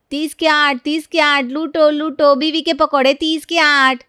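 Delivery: loud, high hawker's cry in her sleep; street hawker calling. Acted. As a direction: call out prices like a street vendor. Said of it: "नींद में प्रिया के बड़बड़ाने की आवाज़". (सपने में ठेलेवाली की तरह ऊँची आवाज़ में पुकारती)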